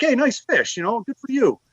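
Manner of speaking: half-hearted holler across the water; retold yelling back. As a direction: call back without picking a fight. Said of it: "I'm yelling back".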